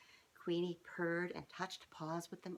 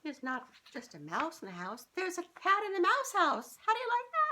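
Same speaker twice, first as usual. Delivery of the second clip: rising to a high, loud royal cat voice; high, loud, proud cat queen; haughty cat; 12 semitones higher, 9 dB louder than usual. Acted. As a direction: play the grand cat queen, building to a crow. Cat Queenie announces herself.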